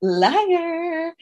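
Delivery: high sing-song accusation; mock accusing. Hosts chanting at the liar, high sing-song taunt. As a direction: taunt it like a child. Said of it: "You are such a fucking liar".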